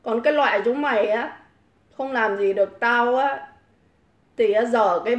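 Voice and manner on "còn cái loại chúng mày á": usual speaking voice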